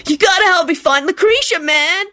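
high, whiny plea; whining. Acted. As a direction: whine for help. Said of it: "Kenny whined".

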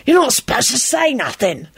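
menacing reproach; threatening hiss. A narrator's reading. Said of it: "Victor hissed threateningly".

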